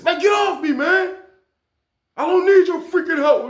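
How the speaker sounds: high, loud yell to back off; angry shout. Retold or imitated. Inmate telling the guard to back off, loud angry shout. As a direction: bark it at someone who is helping.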